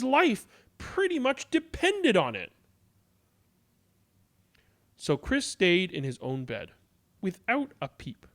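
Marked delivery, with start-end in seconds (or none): none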